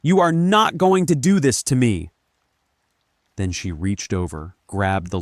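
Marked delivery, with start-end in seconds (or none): 0.0-2.1 high, loud, furious scream. A narrator's reading. Linda refuses to be betrayed, screaming. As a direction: scream it with force on every word. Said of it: "she screamed".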